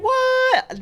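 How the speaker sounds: sudden loud high what; shocked what. Real, not acted. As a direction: blurt it out in disbelief. Shocked she lives on island.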